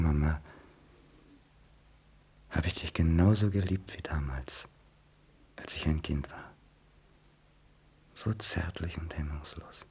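a son whispering his love to his mother; tender whisper. Acted. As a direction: breathe the memory softly. Son tenderly recalls loving mother.

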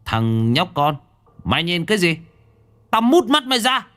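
rising to a high furious yell; threatening shout. A narrator's reading. Chinese bodyguard threatening Hải Nghẹo, furious high shout. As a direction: bark the threat in his face.

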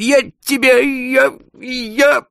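high, loud sputtering repeats; a boss too angry to finish; stammering with rage. A narrator's reading. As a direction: choke on the words in fury. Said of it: "заикался Каир".

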